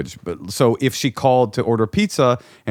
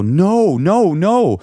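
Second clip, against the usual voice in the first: much higher and louder, alarmed no; horrified protest; 11 semitones higher, 6 dB louder, much slower than usual. Retold or imitated. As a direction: cry out no in a panic.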